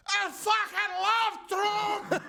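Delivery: put-on high, drawn-out drunken yell; drunken pub shout. Comic retelling of an Irish pub regular, drunken yell. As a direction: imitate a drunk bellowing his love across the bar. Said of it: "so you assume everyone's drunk. You just hear".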